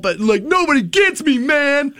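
sulking teenager who feels misunderstood, mockingly; mock angsty teen. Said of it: "where I was like".

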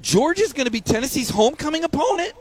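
high, incredulous, half laughing; mock outrage, laughing at the absurdity; exasperated disbelief. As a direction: sell the shock of a fan seeing a bad schedule. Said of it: "I'm like".

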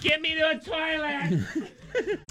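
desperate office lady, laughing. Pretend. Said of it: "upset stomach of an office lady".

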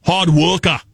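hammy Boston accent, dropped r's. Shown as a demonstration. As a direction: put on a broad Boston accent. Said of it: "most confident accent is Boston".

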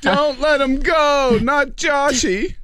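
very high, slow mock wail; hammed-up fan begging, words drawn out; pleading fan. Host mocking online fans drooling over Josh Dobbs, mock pleading. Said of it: "Everybody was drooling over him".

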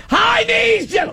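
high-pitched mock holler; imitated coach yell. Host echoing a caller's coach, mock shouting. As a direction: mimic a coach shouting from the sideline. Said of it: "what the people yell".

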